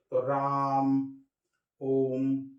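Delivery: slow, राम and ओम held many beats; very drawn-out vowels. Shown as a demonstration. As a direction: stretch राम and ओम slowly, far past normal. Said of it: "कई गुना समय लग रहा है".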